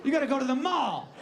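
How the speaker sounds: high-pitched mock horror; hammed-up scandalized outburst; appalled doctor. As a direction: recoil in panicked outrage.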